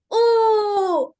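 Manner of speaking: loud, high, drawn-out happy "oooh". Shown as a demonstration. Teacher demonstrating an emotive vowel, happy held U. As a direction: let out a long, bright-spirited, high U. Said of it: "vamos a hacer una U feliz".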